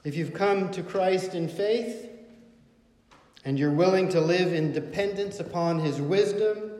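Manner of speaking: preacher rallying believers with rising force; building exhortation. Acted. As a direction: lift them toward comfort.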